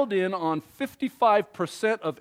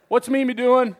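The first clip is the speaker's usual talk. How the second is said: ordinary talk, nothing marked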